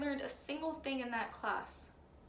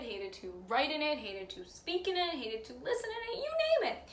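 rising higher and higher to the end; exasperated list; 3 semitones higher than usual. Acted. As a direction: pitch climbing with each item.

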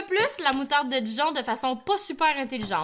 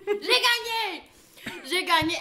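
triumphant shout; 7 semitones higher, slower than usual. Real, not acted. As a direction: crow that you won. Triumphant after mustard contest.

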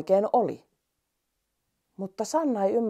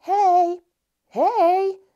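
high, louder mouse calling; 13 semitones higher, 5 dB louder than usual. Acted. Mouse calling out to the sleeping lion, high and loud. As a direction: call up to the lion from far below. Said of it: "hiirulainen huuteli".

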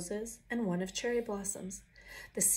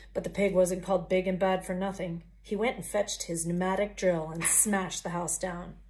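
plain reading voice

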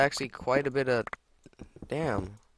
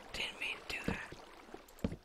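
whispering; 12 dB quieter, slower than usual. Real, not acted. Quiet regret after mistake.

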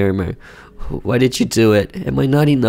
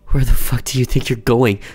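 usual speaking voice

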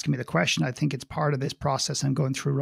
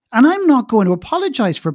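louder, higher defiant vow; 8 semitones higher, 7 dB louder than usual. Real, not acted. Speaker declaring to interviewer, loud and defiant. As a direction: refuse to apologize, boldly.

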